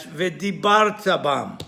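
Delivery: V'dibarta bam stressed on "bar"; stress before last syllable. Shown as a demonstration. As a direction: punch "bar" in V'dibarta, leaving "ta" light. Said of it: "They pronounce it as".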